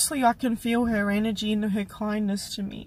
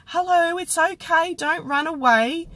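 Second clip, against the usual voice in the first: put-on higher, louder gentle reassurance to a scared child; kind old lady; 8 semitones higher, 5 dB louder than usual. Old lady calming a scared girl, gently reassuring. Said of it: "she said".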